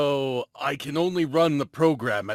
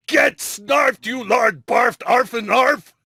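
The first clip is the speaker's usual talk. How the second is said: much higher, loud taunting yell; gloating shout; 9 semitones higher, 5 dB louder than usual. Acted. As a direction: scream the insult in triumph. Villain gloats at the heroes.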